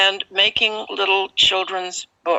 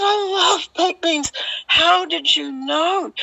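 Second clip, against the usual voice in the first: high, thrilled, slowing on the question; delighted; 10 semitones higher than usual. Retold or imitated. Telling the lady she loves baked beans, overjoyed. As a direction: gush with joy.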